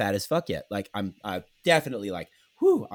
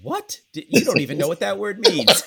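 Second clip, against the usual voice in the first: incredulous what; 8 semitones higher, 5 dB louder than usual. Real, not acted. Baffled at friend's word choice.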